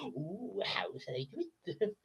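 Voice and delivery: whispered villain plotting; Yzma scheming. Shown as a demonstration. [name] as Yzma whispering her plan. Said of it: "I can do Yzma".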